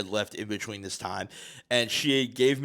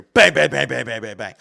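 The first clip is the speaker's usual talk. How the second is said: rapid-fire loud bangs, falling off; imitated door pounding; 3 semitones higher, 10 dB louder than usual.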